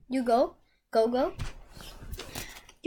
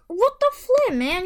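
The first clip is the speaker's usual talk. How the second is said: exasperated; 7 semitones higher, 6 dB louder, slower than usual. Real, not acted. Annoyed at the wrong pull.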